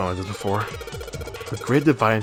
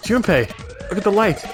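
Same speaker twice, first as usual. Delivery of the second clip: urgent character voice; 9 semitones higher than usual. Acted. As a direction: call his attention urgently. Voicing character pointing out light.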